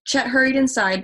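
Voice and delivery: plain reading voice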